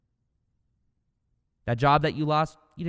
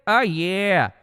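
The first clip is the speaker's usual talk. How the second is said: loud, higher "Oh, yeah"; hyped catchphrase; 6 semitones higher, 6 dB louder than usual. Shown as a demonstration. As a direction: celebrate with a shout. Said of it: "catchphrase again".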